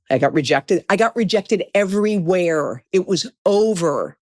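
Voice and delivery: ordinary delivery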